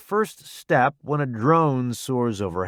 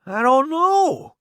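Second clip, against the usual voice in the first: high, slow, raised voice; honest shouted answer; 13 semitones higher, 4 dB louder, slower than usual. A narrator's reading. Rescuer answering the newbie under bombing, honest shouted answer. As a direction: call it out candidly. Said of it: "he answers honestly".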